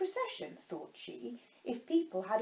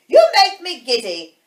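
loud, high, irritable outburst; screamed complaint; 5 semitones higher, 21 dB louder than usual. A narrator's reading. Queen annoyed by the bowing gardeners, screaming. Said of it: "screamed the Queen".

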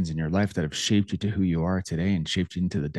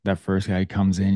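normal talking voice

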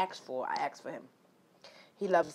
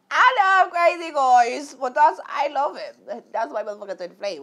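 high, loud lovestruck squeal; giddy excitement; 7 semitones higher, 10 dB louder than usual. Real, not acted. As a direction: gush about him, out of control. Gushing about loving him.

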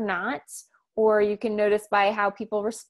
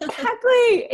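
laughing; 14 semitones higher, 6 dB louder, slower than usual. Real, not acted. Laughing agreement with S1.

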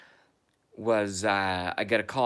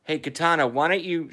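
calling a cat; 6 semitones higher, 3 dB louder than usual. Real, not acted.

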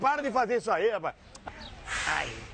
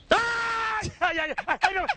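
pain scream; 13 semitones higher, much slower than usual. Acted. Prank victim screams at masseuse.

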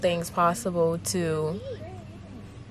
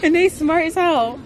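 high, loud laughing exclamation; excited shout; 10 semitones higher, 4 dB louder than usual. Real, not acted. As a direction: shout it out with delight. Excited about the goats.

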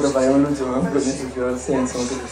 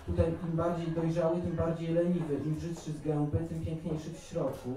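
softer, phrase-by-phrase poem reading; poetry recitation; 10 dB quieter than usual. Acted. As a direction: recite verse in measured lines, growing quieter. Reads second poem aloud.

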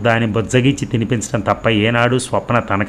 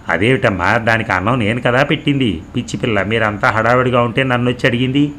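ordinary delivery

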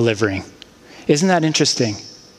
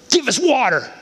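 higher, curt demand for water; rude demand; 7 semitones higher than usual. Retold or imitated. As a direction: bark it like an entitled crowd. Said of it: "Just like that. That's how it sounded".

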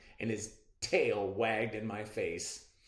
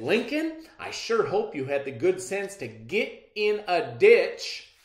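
raised, louder scolding; pointed grandmotherly scolding; stern; 8 semitones higher, 7 dB louder than usual. Acted. Grandmother to her son after the storm, stern. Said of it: "gave him a stern look and said".

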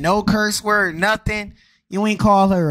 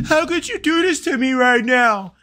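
mocking whiny dad; 5 semitones higher than usual. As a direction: ham up the betrayed dad's plea. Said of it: "talks to women and says".

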